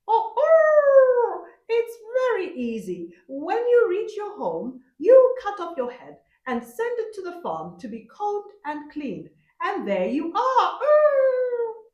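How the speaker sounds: very high, loud cackling rooster; a boastful rooster fooling the leopard, laughing; shrieking high rooster voice with a laugh; rooster's voice. A narrator's reading.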